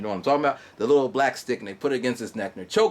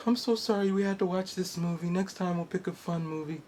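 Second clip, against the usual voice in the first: put-on 1 apologetic girlfriend; 7 semitones higher, 5 dB quieter than usual. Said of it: "my girlfriend's like".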